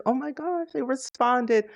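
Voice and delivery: high-pitched gushing fan reaction; imitated fan excitement. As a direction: mimic a fan freaking out over a reply. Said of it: "and they freak out".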